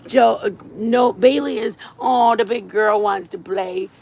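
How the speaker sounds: ordinary talk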